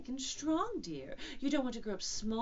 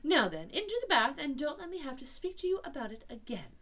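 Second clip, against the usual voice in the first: louder, higher motherly scolding; rather severe; 6 semitones higher, 7 dB louder than usual. Acted. Kanga warning Roo about making faces, rather severe. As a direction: lecture firmly, as to a naughty child. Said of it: "said Kanga rather severely".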